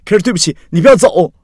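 high, loud begging; desperate plea. A narrator's reading. Axi sees Carrie packing, pleads loudly and desperately.

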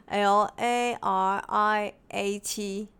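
slow letter-by-letter L A R I A T; spelling it out. Shown as a demonstration. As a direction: spell out L A R I A T slowly, one letter at a time. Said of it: "拼一下。". (慢慢逐个字母拼出 L A R I A T)

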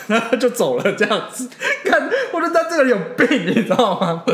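laughing loud and high while telling. Real, not acted. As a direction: burst out laughing through the words. Laughing at the absurd story.

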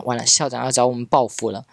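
usual speaking voice

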